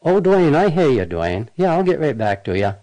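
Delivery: loud and high, hurrying him off; dodging a caller. Real, not acted. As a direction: wrap up the call quickly. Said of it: "you see how easy that was".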